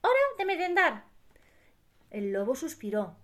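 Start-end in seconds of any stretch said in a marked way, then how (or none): 0.0-1.0 high-pitched lamb announcing; high cartoon lamb voice; little sheep. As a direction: pipe it up as a tiny sheep.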